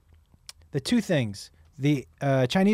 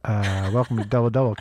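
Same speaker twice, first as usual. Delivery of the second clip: low-pitched fast-food greeter; drive-thru worker; 8 semitones lower than usual. Pretend. Host restarting the drive-thru role-play, drive-thru worker. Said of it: "I'm gonna be the drive-thru person".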